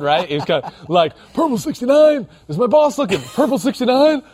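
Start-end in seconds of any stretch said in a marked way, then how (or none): 1.3-4.2 high, squeezed mock-furtive snap count; furtive at work. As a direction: imitate a guy sneaking a snap count past his boss. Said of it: "didn't wanna be really loud".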